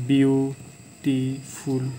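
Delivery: Beautiful very slowly in three parts, বিউ, টি, ফুল; syllable by syllable. Shown as a demonstration. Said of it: "এটাকে আমরা তিনবারে". (Beautiful ধীরে তিন ভাগে ভেঙে বলা)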